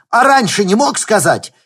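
fast, high, loud panicky talk; panicked. Acted. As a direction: rattle it off in alarm.

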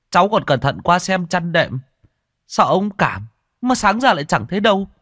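a scared son explaining fast; frightened boy. Acted. Boy tells the old healer his father vanished, high and pleading.

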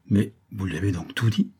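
ordinary delivery, nothing marked

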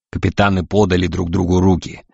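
ordinary delivery

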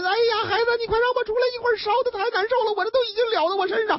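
a trapped mother begging through the door; storyteller acting a burning mother's plea; desperate pleading. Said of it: "他妈妈在里面拍门".

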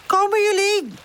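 high, impatient shout to the others; impatient call. A narrator's reading. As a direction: call them over, fed up with waiting. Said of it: "roept hij ongeduldig".

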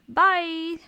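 high, loud parting shot; called-out goodbye. A narrator's reading. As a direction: toss out a loud farewell. Parting call before running off.

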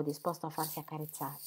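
usual speaking voice

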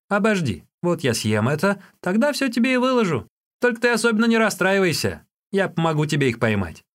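high, lively stalling; Юньге, teasing but helpful. A narrator's reading. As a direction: promise help in a high boyish pitch.

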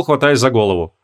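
plain reading voice

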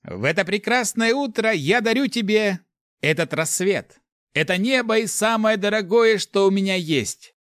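high, loud, ceremonial announcement; pompous declaration of love; loud solemn proclamation. A narrator's reading. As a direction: proclaim it grandly, holding the pitch up. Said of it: "громким и торжественным голосом объявлять".

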